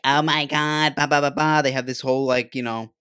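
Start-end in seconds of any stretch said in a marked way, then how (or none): 0.0-1.6 mock airhead girl voice, a bit louder; mocking girly chatter. Podcast host mocks how girls sound. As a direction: imitate girls playing the game. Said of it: "they sound like".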